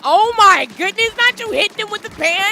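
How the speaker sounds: playful scandalized squeal; shocked outrage. Real, not acted. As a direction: shriek in mock offence. Reacts to an ally hitting another.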